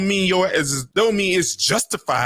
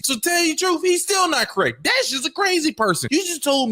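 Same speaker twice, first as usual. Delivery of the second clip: much higher, outraged shouting; exasperated shout; 10 semitones higher than usual. Real, not acted. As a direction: push it up high in disbelief. Heated disagreement in debate.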